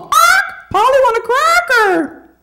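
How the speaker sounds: high squawking parrot, far up in pitch. Retold or imitated. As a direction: squawk it like a parrot begging for food. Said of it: "everybody talks when they're hungry".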